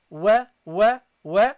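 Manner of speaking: teacher drills the syllable wa; waw with fatha. Shown as a demonstration. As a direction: repeat wa firmly like a drill.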